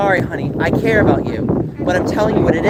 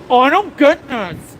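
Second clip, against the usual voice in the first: mocking snob; 7 semitones higher than usual. Mocking dismissive commenters.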